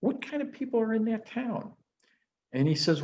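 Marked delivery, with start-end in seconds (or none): 0.0-1.7 traveler asking, voiced higher. Couple asks the sage.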